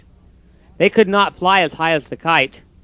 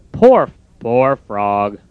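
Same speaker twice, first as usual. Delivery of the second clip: low, very slow pitying voice; sorry Toad; 6 semitones lower, much slower than usual. Acted. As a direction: feel sorry for his friend. Toad's voice.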